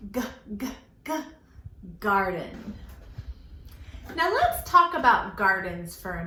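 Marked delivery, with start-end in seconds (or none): 0.1-1.1 "G" as a short hard guh sound, repeated; teacher modelling the letter sound "G" for kids. Shown as a demonstration. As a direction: say "G" as just the sound guh, crisp. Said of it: "because G says".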